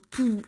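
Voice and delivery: "Poof" blown out through puffed cheeks; short airy "Poof" demo; puffing poof. Shown as a demonstration. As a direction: puff it out like blowing up your cheeks. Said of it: "then you go".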